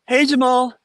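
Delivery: high, bright polite hello; mock the nice student crowd; sweet friendly greeting. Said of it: "They're just".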